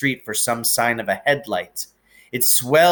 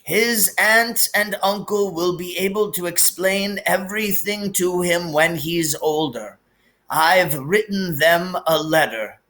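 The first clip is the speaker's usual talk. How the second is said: put-on gentle sorrowful old wizard; wise old headmaster, lightly voiced; 7 semitones higher than usual. A narrator's reading. Dumbledore figure held.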